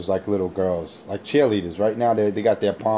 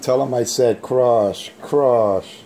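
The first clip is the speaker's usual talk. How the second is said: slow, heavy, louder "crush, crush"; drawn-out crush; 3 semitones higher, 4 dB louder, much slower than usual. Real, not acted. Taunting Billy Bob over the radio, slow menacing crush. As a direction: lean hard on "crush".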